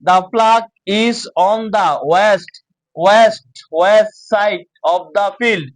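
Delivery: slow, emphatic separated reading; deliberate classroom model reading; word by word. Shown as a demonstration. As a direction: read each word clearly for learners. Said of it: "আলাদা আলাদা করে".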